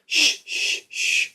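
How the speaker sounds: soothing shush. Retold or imitated. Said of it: "sometimes you'd go like".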